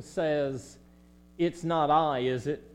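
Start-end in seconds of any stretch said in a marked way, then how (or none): none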